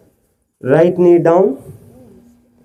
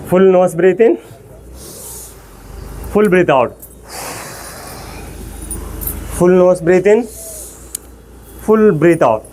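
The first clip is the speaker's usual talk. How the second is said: breathing coach cue; 3 semitones higher, much slower than usual. Shown as a demonstration. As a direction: breathe in on a rising call, breathe out falling. Leads breathing drill. (శ్వాస వ్యాయామం చెప్పే యోగా గురువు స్వరం)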